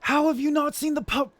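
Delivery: high, loud incredulous; excited disbelief. Real, not acted. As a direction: shout it in disbelief.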